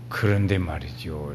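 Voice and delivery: low, soft, unhurried Chungcheong drawl; slow Chungcheong speech. Retold or imitated. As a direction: imitate an easygoing Chungcheong speaker, low and quiet. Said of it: "이렇게 천천히 말을 해요".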